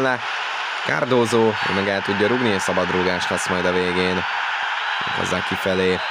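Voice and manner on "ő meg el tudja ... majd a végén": ordinary delivery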